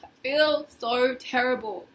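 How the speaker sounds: loud anguished groan into slow words; groaning distress. Shown as a demonstration. Tapping coach demonstrating feeling awful, a loud anguished groan. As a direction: groan it out, heavy and miserable. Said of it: "make a sound like".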